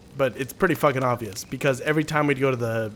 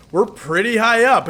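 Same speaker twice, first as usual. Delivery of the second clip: higher, louder and slow teasing; a kid needling his scared dad, drawn out; 9 semitones higher, 7 dB louder than usual. Retold or imitated. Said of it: "he pans it back ... he goes".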